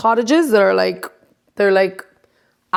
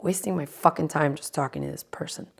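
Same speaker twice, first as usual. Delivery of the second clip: whispered grumble; 3 semitones lower, 10 dB quieter than usual. Retold or imitated. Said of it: "I was like".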